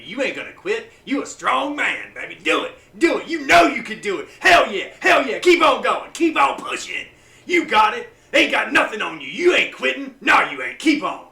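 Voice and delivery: pep talk building to loud, high shouts; hyping himself up. Shown as a demonstration. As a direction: fire yourself up with full-throated shouting. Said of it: "I will hype myself up".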